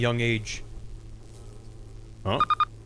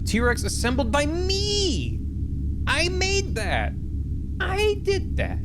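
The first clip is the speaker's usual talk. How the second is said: proud exclaiming; 13 semitones higher, slower than usual. Pretend. Proudly reading out own dinosaur build.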